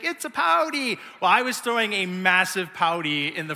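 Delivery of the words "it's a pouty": high teasing singsong imitation of dad. A son recalls his dad teasing him about pouting, in high singsong. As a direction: tease like a father mocking a sulking kid. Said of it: "he's like".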